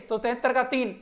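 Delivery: ordinary talk, nothing marked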